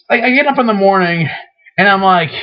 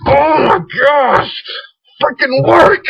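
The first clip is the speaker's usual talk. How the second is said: high, strained mock outburst building to a yell; exasperated outburst; 7 semitones higher, slower than usual. Fed up with a gadget that won't work, exasperated outburst. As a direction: act out losing it, higher and higher. Acting out frustration at broken device.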